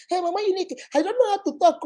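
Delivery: high, mocking imitation of a complainer; mimicking excuse-maker. Mimics women boasting of bad mouths.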